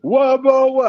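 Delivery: high, loud garbled outburst; imitated heckler. Woman answering the singer's question, imitated heckler. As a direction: burst in with the woman's wail. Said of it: "And she goes".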